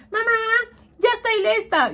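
high, loud daughter voice; teen daughter announcing. Retold or imitated. Lily calling to her mother from the kitchen, high and loud. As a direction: call out like an eager teenager. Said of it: "entra a la cocina Lily".

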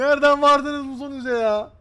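loud, high-pitched protest at chat; exasperated outburst. Real, not acted. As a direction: burst out in real exasperation. Exasperated outburst at chat's advice.

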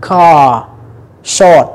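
car, short in a British accent, slow, no R; long open vowels of British English, R dropped. Shown as a demonstration. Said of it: "đọc nó dài hơn một chút xíu". (giọng Anh-Anh, kéo dài nguyên âm)

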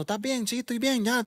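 high, hurried crash-scene exchange; retold alarm in a high voice; imitated urgent check. Retells panicked check after crash.

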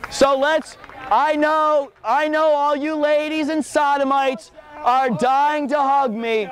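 ordinary talk

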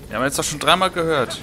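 high, loud and fed up; annoyed outburst. Real, not acted. Game audio repeats the briefing.

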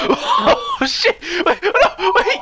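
startled yell; shocked shout. Real, not acted. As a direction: blurt it out in shock. Sudden in-game panic.

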